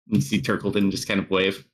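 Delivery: usual speaking voice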